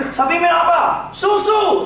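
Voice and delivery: high playful quiz shout; quiz call and answer. Acted. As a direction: call out the question like a game host. Acted cow quiz game.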